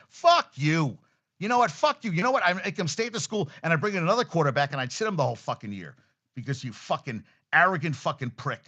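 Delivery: heated, indignant tirade; real anger. Real, not acted. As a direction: cuss out the player in a rising fit of temper. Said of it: "I'm, I'm angry".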